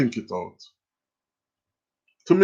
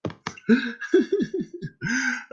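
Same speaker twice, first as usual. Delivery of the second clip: high breathy burst of laughter; 10 semitones higher, 6 dB quieter than usual. Real, not acted. Host laughing at Empress's answer, high laugh. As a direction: crack up in disbelief.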